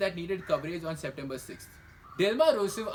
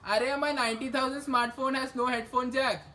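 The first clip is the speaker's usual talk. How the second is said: whiny phone owner; 5 semitones higher than usual. Retold or imitated. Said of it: "people all over the world will start wishing".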